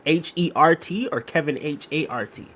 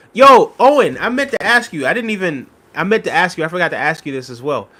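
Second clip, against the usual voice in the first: ordinary talk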